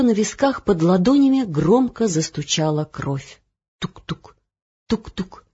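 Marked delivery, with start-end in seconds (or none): 3.8-5.4 softer, knocking beat; heartbeat. A narrator's reading. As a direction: knock it out quietly. Heartbeat in her temples. (тихий стук)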